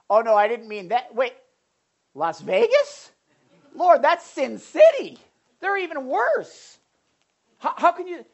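very high, loud mock disbelief; horrified protest. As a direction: squawk in hammed-up horror. Prophet's acted horrified protest to God.

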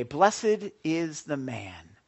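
verse read low, very slowly; slow recitation. Real, not acted. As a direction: space out each word of the verse. Repeats verse more slowly and calmly.

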